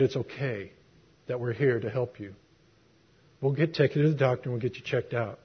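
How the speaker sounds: soft, calm reassurance to a scared patient; reassuring fireman. Retold or imitated. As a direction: quietly soothe a frightened man.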